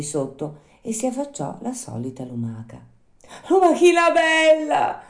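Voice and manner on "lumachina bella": high, loud puppet shout; calling out. Acted. Pinocchio hailing the snail from the street, high shout. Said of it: "gridò Pinocchio dalla strada".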